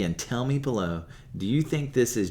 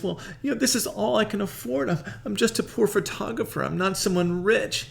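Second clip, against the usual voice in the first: much higher, sheepish put-on voice; ashamed Peter; 11 semitones higher than usual. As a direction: mumble an excuse, embarrassed. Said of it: "he's feeling some shame and he says".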